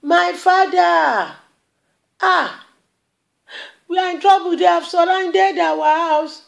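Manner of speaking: a servant crying out in panic, voice up high; terrified servant calling his master; frightened shout. Acted. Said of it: "And he shouted".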